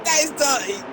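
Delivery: shocked disbelief. Real, not acted. Reacting to a dirty overtake.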